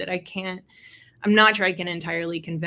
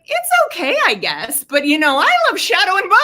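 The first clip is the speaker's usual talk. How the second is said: pitched-up, louder mock son, shrugging then gushing; imitating her son; 11 semitones higher, 9 dB louder than usual. Mom imitating her son's reply about books, pitched up and louder. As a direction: play the kid who shrugs it off, then raves.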